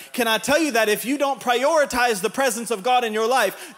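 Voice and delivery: ordinary delivery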